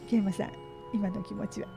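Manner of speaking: ordinary talk, nothing marked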